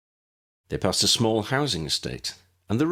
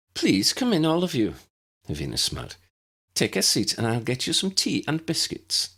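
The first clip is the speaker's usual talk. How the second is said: put-on higher, kind older hostess voice; lightly voiced welcoming lady; 7 semitones higher than usual. A narrator's reading. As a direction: welcome the guests warmly in a lifted voice. Hevina voiced as character.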